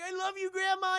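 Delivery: put-on little girl voice. Little daughter Pebble to grandma, high child voice. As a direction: play a tiny girl hugging grandma. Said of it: "and she's like".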